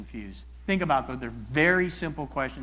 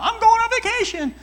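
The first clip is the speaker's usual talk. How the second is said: much higher and louder, gushing voice; excited friend; 9 dB louder than usual. Retold or imitated. Friend answering the question, bubbling with excitement. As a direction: gush it like you can't wait to go. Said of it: "what are you all geeked out about".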